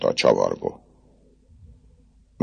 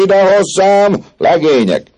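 much higher, loud slow summons; rally the crowd to you; calling out; 8 dB louder, slower than usual. Acted. Jean drags the ladder across the square, loud rallying call. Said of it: "közben ezt kiáltozta".

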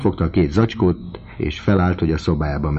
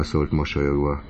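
plain reading voice

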